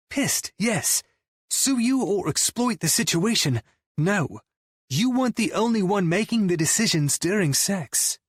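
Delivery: high-pitched, exasperated argument; indignant rebuttal. A narrator's reading. Friend disputing bandmate's sole blame, indignant rebuttal. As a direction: argue back hotly, pitch well up. Scotty argues back at his bandmate.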